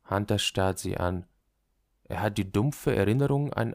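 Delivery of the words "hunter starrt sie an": ordinary delivery, nothing marked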